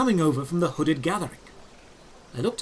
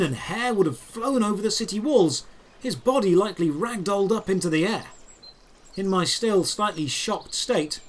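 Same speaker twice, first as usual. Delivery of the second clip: plain reading voice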